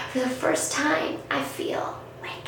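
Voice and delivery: soft hushed whisper. Retold or imitated. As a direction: breathe it out as a secret. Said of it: "at the end she goes".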